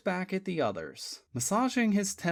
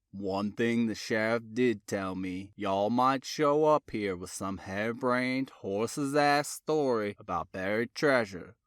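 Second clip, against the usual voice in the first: lower, unhurried Virginia Tidewater drawl; 6 semitones lower than usual. A narrator's reading. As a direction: lay on the Tidewater drawl, matter-of-fact. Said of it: "in his slow Tidewater drawl".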